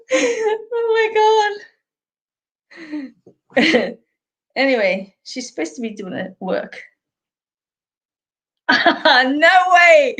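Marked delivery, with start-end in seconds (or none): 0.1-1.6 high squealing laugh. Real, not acted. Laughing at a viewer's comment. 8.7-10.2 loud laughing no way; laughing protest. Real, not acted. Reacting to a viewer's comment.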